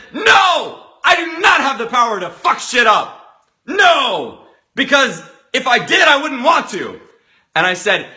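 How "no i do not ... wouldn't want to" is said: high, loud, shouted protest; emphatic refusal. Retold or imitated. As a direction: push back hard, voice pitched up. Group's emphatic refusal acted out.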